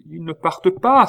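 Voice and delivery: loud, high-pitched protest; acted indignant outcry; crying out. A narrator's reading. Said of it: "s'écria la reine".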